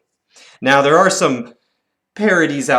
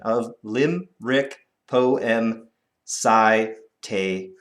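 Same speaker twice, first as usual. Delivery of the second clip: slow, even slower recital; 4 semitones lower, slower than usual. Shown as a demonstration. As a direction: spell the syllables out slowly. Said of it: "One, two".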